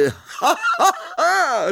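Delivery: very high-pitched mocking guffaw; big theatrical belly laugh; roaring laughter. A narrator's reading. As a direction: burst out in derisive ha-ha. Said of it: "ryknęła głowa Sir Patryka".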